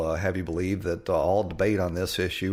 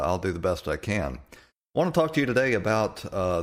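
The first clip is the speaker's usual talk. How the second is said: normal talking voice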